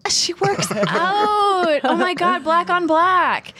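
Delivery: high, drawn-out gushing with a laugh; playful high-pitched fawning; hammed-up hype. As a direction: gush over the outfit, stretching the words. Hyping up guest's gym outfit.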